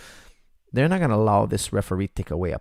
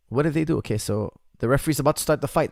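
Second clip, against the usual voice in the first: normal talking voice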